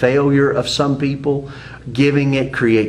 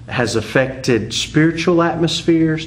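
usual speaking voice

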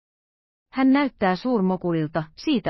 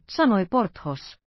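usual speaking voice

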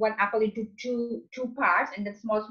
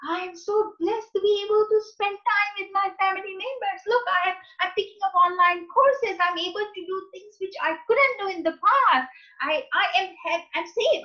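much higher, bright singsong gratitude; upbeat positive affirmations; 10 semitones higher than usual. Shown as a demonstration. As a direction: gush with exaggerated cheer. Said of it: "instead of saying all the negative things".

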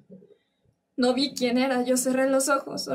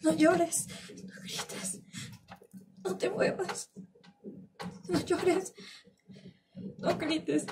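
high, hushed, anguished repetition; trapped in the memory, voice thin and small; fearful reliving; 5 semitones higher, 8 dB quieter, slower than usual. Acted. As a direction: choke out the remembered orders softly, pitched high. Relives the attacker's repeated orders.

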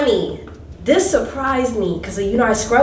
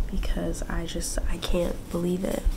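softer, whispered disbelief; awed whisper; 3 semitones lower, 7 dB quieter than usual. Real, not acted. Overwhelmed by her room, whispered disbelief. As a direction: breathe it out, overwhelmed.

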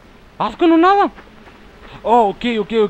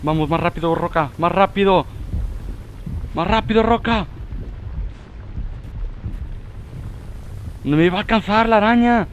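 anxious; 5 semitones higher than usual. Real, not acted. Fleeing the spider.

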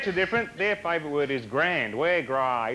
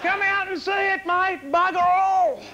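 high-pitched holler; shouted sales pitch; 12 semitones higher, 3 dB louder than usual. Acted. Mock tourism ad calling visitors to the outback, high shout. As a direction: call it out high, building to a loud finish.

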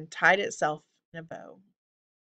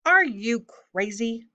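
higher, exasperated holler; raised-pitch, incredulous call; mild holler; 5 semitones higher, 4 dB louder, slower than usual. A narrator's reading. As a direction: holler it at your brother, without full force. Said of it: "Mallory hollered at her brother".

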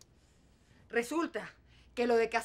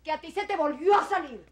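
much louder and higher, biting anger; furious retort; 6 semitones higher, 6 dB louder than usual. Acted. Mother snaps back at her selfish daughter, loud and furious. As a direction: lash back at the spoiled child.

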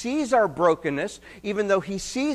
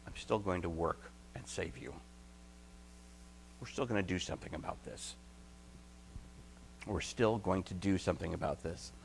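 gentle divine voice; 12 semitones lower, 17 dB quieter, faster than usual. Acted. God's gracious words voiced softly.

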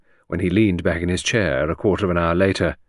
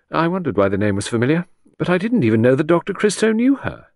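higher, quicker secretary, realizing; lightly voiced secretary; 8 semitones higher, 4 dB louder than usual. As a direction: lightly play a secretary placing a name. Secretary's voice in narration.

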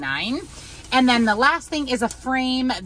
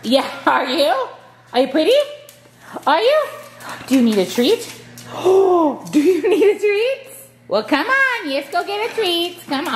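much higher, louder sing-song to the dogs; pet talk; 9 semitones higher, 4 dB louder than usual. Real, not acted. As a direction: owner offering the dog a treat in a high coo. Cooing at dogs with treats.

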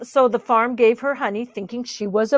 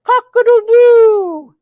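loud, high, long crow; crowing rooster; 11 semitones higher, 8 dB louder than usual. A narrator's reading. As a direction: crow out the morning call. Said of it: "The rooster said".

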